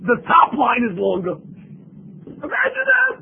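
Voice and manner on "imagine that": much higher, cracking with disbelief; incredulous cry; astonished exclaim. Real, not acted. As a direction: marvel out loud at the result. Marveling at conformity result.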